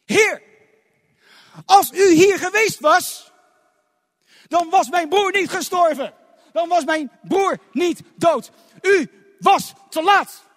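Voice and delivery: slow, loud, high anguished cry to Jezus. Acted. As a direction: cry out the accusation in grief. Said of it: "En wat riep ze?".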